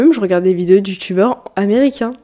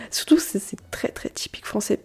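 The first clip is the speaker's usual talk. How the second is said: hushed whisper, like sharing a secret; whispered aside; 8 dB quieter than usual. Real, not acted. Podcaster whispers a confession to her listeners. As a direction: lean in and whisper it. Confiding aside about the French.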